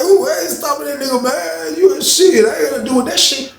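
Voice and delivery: high comic protest, near laughing; squeaky-high bystander imitation; incredulous refusal. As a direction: refuse in mock disbelief. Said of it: "everybody was like".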